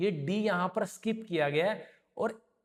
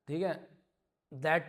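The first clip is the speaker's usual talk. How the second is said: normal talking voice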